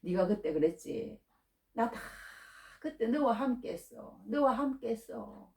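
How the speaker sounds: softly voiced God, with long pauses; God's reassuring reply. God tells the struggling believer he was there, quietly reassuring. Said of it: "하나님이 요즘 그러시더라고".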